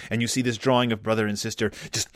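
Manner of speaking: ordinary delivery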